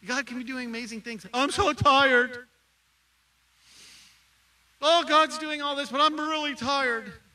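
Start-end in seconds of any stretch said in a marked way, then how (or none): 1.3-7.2 mock whiny complaint. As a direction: whine like a tired self-pitying churchgoer. Mocking own complaining.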